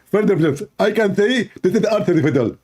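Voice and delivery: high, whiny caricature; lampooned debater insisting it is fake; mock Zakir voice. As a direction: squeak out the excuse.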